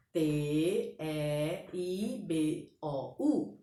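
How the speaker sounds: slow letter-by-letter spelling; spelled out. Shown as a demonstration. Teacher dictates what students write between slashes, slow spelling. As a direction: spell it very slowly, a gap after each letter. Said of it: "Então, você pode colocar".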